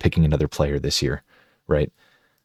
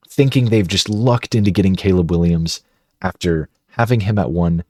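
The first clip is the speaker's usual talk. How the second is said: ordinary talk, nothing marked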